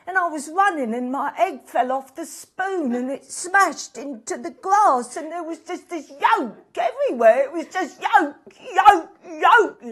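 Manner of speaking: hammed-up distraught retelling; mounting hysteria. Guest relives egg race disaster, distraught.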